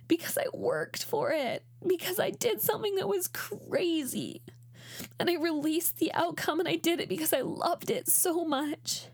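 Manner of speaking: high, tearful, halting voice; choked up. Real, not acted. Athlete reliving becoming an Olympian, choked up. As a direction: hold back tears between long pauses, voice lifted high.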